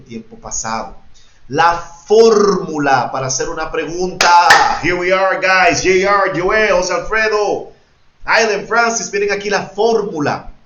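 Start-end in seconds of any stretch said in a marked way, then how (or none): 1.5-10.5 loud, raised announcement to the class; calling out. Real, not acted. Teacher summons students by name to see the formula, calling out. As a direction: call out loudly to get every student looking.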